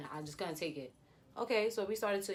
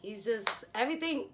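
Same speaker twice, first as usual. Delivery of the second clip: ordinary talk, nothing marked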